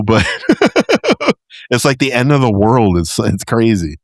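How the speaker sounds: ordinary talk, nothing marked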